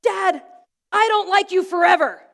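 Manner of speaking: put-on high, loud, shouted child tantrum; imitating an angry small boy yelling at his dad; angry four-year-old. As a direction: shout it like a furious little kid. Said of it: "sometimes he says things like".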